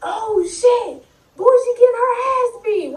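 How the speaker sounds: high excited onlooker voice; mock crowd hype, high pitched; hyping onlooker. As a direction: egg the fight on like a bystander. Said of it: "all I hear is".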